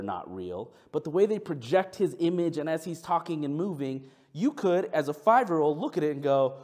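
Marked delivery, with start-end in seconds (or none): none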